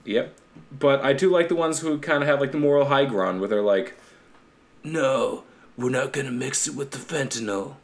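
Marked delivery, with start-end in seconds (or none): 4.8-7.8 softer, badly faked Italian mob boss; Godfather. Host voicing principled mobsters, bad Italian don voice. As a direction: do a poor hushed mafia don. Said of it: "That was my best, like, Godfather".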